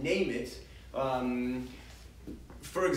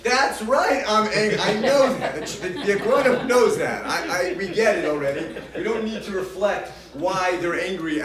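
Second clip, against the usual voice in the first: much louder and higher, laughing mock outburst; mock angry spouse; 5 semitones higher, 10 dB louder than usual. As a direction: play the irritated spouse snapping back with a laugh. Annoyed spouse responding, laughing.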